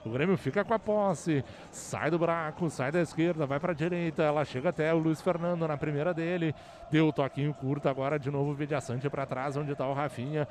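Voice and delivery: rapid radio football narration; play-by-play. Real, not acted. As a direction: call the match live, brisk and steady.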